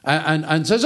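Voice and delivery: normal talking voice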